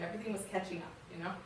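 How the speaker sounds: normal talking voice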